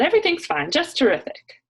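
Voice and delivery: bright, high and loud saleswoman cheer; Macy's voice. Acted. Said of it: "I could hear her Macy's voice coming on".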